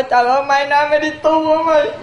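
retold sob-laced wail, half laughing; a hammed-up tearful cry; mock crying wail. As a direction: imitate a neighbour wailing through tears. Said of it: "cô khóc, cô nói".

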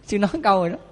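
ordinary delivery

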